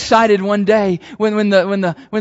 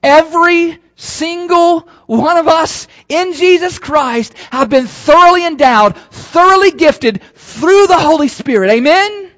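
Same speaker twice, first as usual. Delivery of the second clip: rising, louder preacher's proclamation; preaching at full force; 4 semitones higher, 5 dB louder than usual. Acted. Pastor declaring to the church, preaching at full force.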